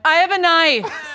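loud, drawn-out announcement of a confession; mock announcement. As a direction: blurt it out loud and slow. Retelling own announcement at school.

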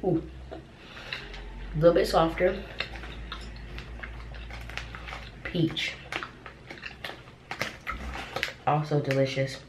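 talking around candy; chewing while talking. Real, not acted. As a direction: mouth partly full. Seen 1: pursed, moving lips and cheek bulge show chewing while speaking.

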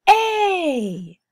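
"A" said high and loud, held long. Shown as a demonstration. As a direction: sing out the vowel "A" clearly.

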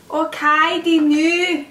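bad Scottish accent. Vlogger announces a Scottish accent for the video, bad Scottish accent. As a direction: put on a badly faked Scottish accent, loud. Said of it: "talking in a really bad Scottish accent".

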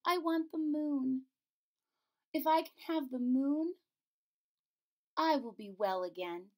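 sick princess asking her father for the moon. Acted. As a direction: ask for the moon like a little girl. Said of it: "said the princess".

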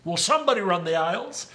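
usual speaking voice

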